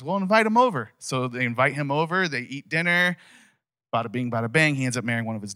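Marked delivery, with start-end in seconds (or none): 0.0-0.9 higher and louder, a friendly dad's call; father inviting the stranger. Retold or imitated. Father tells his daughters to invite Moses, eager and raised. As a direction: play the girls' father saying bring him in. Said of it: "And he goes".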